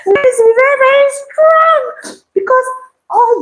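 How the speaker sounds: high, slow, crying mock wail; exaggerated wail. Baker mocking her own failure, high wailing voice. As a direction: wail it like a lament.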